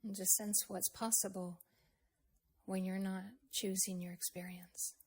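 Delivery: normal talking voice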